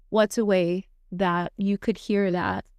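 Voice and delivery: ordinary talk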